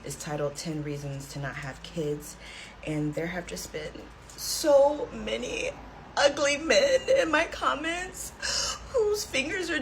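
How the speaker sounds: mock teary, holding back crying; fighting tears. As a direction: pretend to hold back tears. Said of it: "try my best not to cry".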